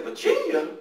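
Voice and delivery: high, stunned "Virginia?"; shocked disbelief. Retold or imitated. Hearing his dad say they're moving, in shocked disbelief. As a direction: react like you can't believe it. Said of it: "I said".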